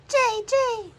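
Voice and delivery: high, loud jay call; blue jay call. Retold or imitated. Narrator repeats the jay call, high and loud.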